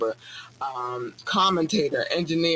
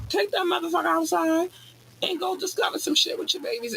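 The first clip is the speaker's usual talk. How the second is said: much higher, squealing with excitement; high excited squeal; 13 semitones higher than usual. Pretend. Urging parents to go stargazing, in a high excited squeal. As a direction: squeal it like a hyped-up kid.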